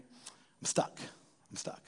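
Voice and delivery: soft, breathy near-whisper of defeat; helplessly stuck. Retold or imitated. Someone who can't forgive admits defeat in a soft near-whisper. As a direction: let it sink away to nothing.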